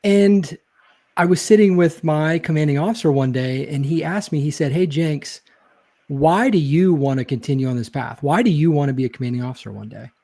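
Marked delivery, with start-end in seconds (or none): none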